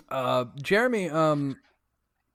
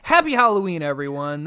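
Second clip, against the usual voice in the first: festive sign-off; 9 dB louder than usual. Real, not acted. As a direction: call out the holiday greeting loudly. Game master signs off to players.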